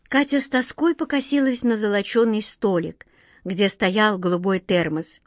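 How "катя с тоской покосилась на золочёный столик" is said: plain reading voice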